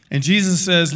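usual speaking voice

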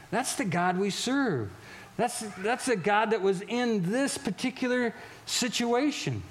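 normal talking voice

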